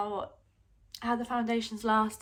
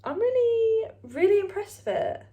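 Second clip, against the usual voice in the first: excited; 12 semitones higher, 8 dB louder than usual. Real, not acted. Excited about makeup lasting.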